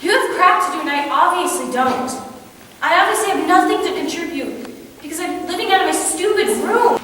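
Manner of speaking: high-pitched exasperation; hurt, angry outburst. Acted. Acted quarrel, telling someone to leave.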